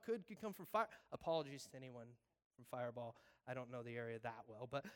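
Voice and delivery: normal talking voice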